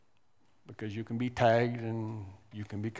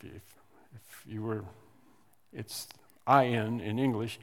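ordinary talk

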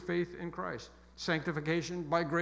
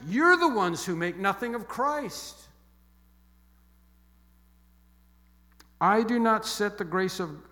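forceful rebuke; 4 semitones higher, 8 dB louder than usual. Real, not acted. Preacher rebuking at full force.

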